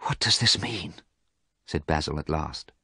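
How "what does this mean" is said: breathless whisper of shock; horrified whisper. Acted. Basil staring at the evil portrait, whispering in horror. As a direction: whisper it, barely able to speak.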